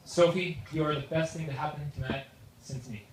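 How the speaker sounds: normal talking voice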